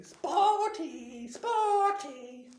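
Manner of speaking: very high, loud singsong jeer; stretched-out playground taunt; taunting yell. A narrator's reading. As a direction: chant it after someone mockingly.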